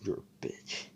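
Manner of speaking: hushed whisper, under the breath; whispered insult. Retold or imitated. Seke insulting Asta behind his back, whispered. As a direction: hiss it so only you hear. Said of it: "And he's like".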